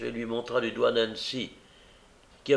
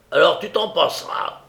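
loud, higher, final retort; angry refusal; 7 semitones higher, 8 dB louder than usual. Acted. Brigand denies Nancy her walk, angry. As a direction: shut the argument down forcefully.

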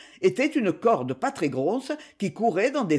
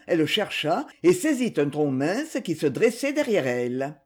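ordinary delivery

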